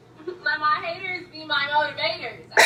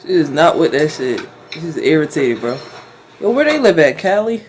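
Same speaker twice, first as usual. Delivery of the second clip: loud; 11 semitones lower, 12 dB louder than usual. Real, not acted. Loud deep car talk.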